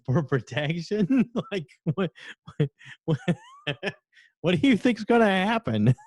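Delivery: high giggly disbelief through laughter; cracking up mid-question; laughing while quoting. Retold or imitated. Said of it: "he could not stop laughing".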